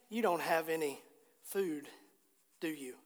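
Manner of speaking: low, soft, with pauses between phrases; hushed, understated compassionate question; quiet compassion. Retold or imitated. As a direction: ask it gently, letting it fall away. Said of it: "I think he said it with compassion".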